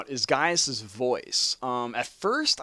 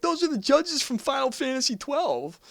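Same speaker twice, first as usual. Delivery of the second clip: mock fan quote; 12 semitones higher than usual. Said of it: "people are probably gonna automatically be like".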